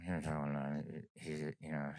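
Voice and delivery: soft, low Cajun mumbling; mock an unclear Cajun talker; mumbled Cajun drawl. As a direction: trail into unintelligible Cajun murmur. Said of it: "I'm throwing a little Cajun in there".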